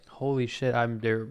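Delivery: ordinary talk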